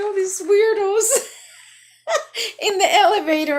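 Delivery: high, loud, laugh-filled; laughing while talking. Real, not acted. Mocking elevator weirdos, laughing loudly. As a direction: push words through laughter. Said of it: "I was cracking up".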